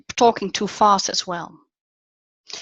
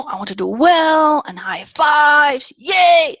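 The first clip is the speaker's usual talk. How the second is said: loud, higher mock pep-talk voice; hyped masculine speaker; 5 semitones higher, 6 dB louder, slower than usual. Coach parodying masculinity in speakers, hyped-up shouting. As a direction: build to a drawn-out cheering "yay". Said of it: "a lot of masculinity in speakers".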